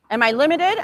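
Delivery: normal talking voice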